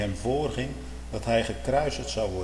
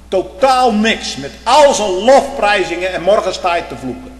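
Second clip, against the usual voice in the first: preaching at full force; 7 semitones higher, 9 dB louder than usual. Real, not acted. Preacher rebuking his congregation, loud fervent preaching. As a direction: hammer it home from the pulpit.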